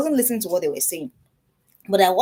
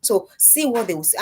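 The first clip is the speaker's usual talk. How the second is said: ordinary talk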